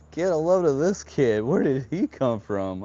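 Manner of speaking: high, loud imitation of jeering kids; mocking school kids. California kids picking on the new kid, loud mocking imitation. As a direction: call out like kids picking on a newcomer. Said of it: "all the California kids were like".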